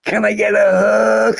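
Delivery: high, loud cooing; cooing girls. Retold or imitated. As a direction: coo like smitten girls. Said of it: "they were like".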